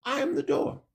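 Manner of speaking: soft echo. Acted. Preacher echoing the Messiah's words to the congregation, softly. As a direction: let the second I am the door fall away to near a hush. Repeats the quote, much softer.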